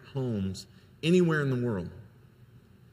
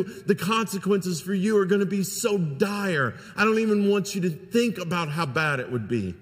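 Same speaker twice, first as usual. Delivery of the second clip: louder, higher, hammered-out threats; retold furious yelling; 7 semitones higher, 5 dB louder than usual. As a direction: mimic a father shouting a warning in his son's face. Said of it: "he is just yelling at me".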